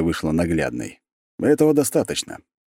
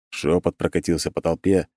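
ordinary delivery, nothing marked

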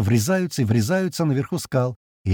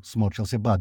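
ordinary delivery

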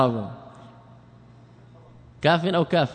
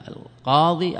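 normal talking voice

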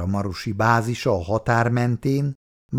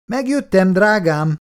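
calling out loud and high on coming home; husband's cheerful loud call through the flat; 10 semitones higher, 5 dB louder than usual. Acted. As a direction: call it from the doorway, much higher. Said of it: "kiáltotta hangosan".

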